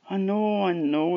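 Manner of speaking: high, pleading apology; hammed-up, sheepish admission; acted contrition. Chuck admits to the lassie his dangerous slip, contrite.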